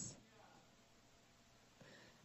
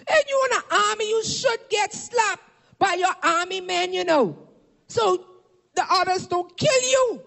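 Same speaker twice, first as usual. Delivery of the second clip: high, shouted sermon cadence; impassioned pulpit shout; full-force preaching; 6 semitones higher than usual. Acted. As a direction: preach it like a rallying battle cry.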